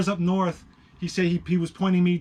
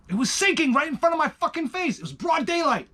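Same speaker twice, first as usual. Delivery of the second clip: high-pitched, rapid, worked-up telling; astonished, high and rushed; incredulous insistence; 12 semitones higher, faster than usual. Real, not acted. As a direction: insist you really saw it, voice way up. Insisting on an unbelievable sight.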